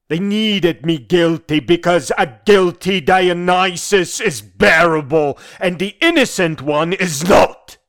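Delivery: loud, high, impassioned denunciation; indignant accusation. Acted. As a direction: hurl the accusation at them. Indignant accusation of his judges.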